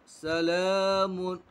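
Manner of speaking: सला with ला held long; stretched ला. Shown as a demonstration. Teacher stretching ला in सला for pupils. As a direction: draw out the second syllable slowly. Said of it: "खींचकर पढ़ेंगे".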